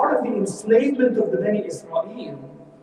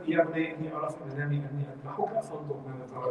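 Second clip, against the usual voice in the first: Quran recitation; 4 semitones lower, 9 dB quieter, much faster than usual. Acted.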